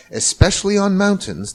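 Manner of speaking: ordinary delivery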